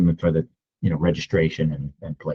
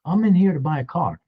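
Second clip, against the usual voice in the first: high, pitched-up mock yell at a customer; retold scream; 10 semitones higher than usual. Lot guy yelling at customer pulling in, high mock yell. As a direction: shout it up high like an outraged lot attendant. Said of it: "screaming at me".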